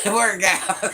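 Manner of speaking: laughing out the corrected word. Real, not acted. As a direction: cracks up at her own slip. Laughing at own slip.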